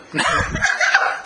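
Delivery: squeaky burst of laughter; high laugh. Real, not acted. Retelling Brandon's Look what I done, high laugh. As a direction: laugh at the absurd answer.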